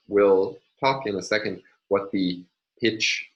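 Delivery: ordinary delivery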